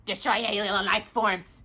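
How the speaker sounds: soft, steady mechanical robot voice. Acted. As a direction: repeat it like a machine. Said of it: "the robot repeated".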